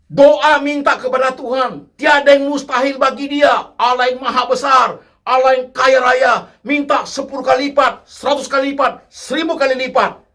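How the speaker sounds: mock grand prayer. Preacher parodying churchgoers demanding tenfold blessings, loud and high. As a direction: proclaim greed like a showy worship leader. Mocks greedy prosperity prayers.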